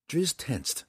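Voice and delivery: usual speaking voice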